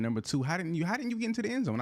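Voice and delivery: how didn't you, incredulous complaint; exasperated. Real, not acted. Annoyed at teammate not scoring.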